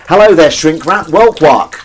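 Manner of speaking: loud, high hello to viewers; calling out a greeting. Real, not acted. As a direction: hail the newcomers at full volume.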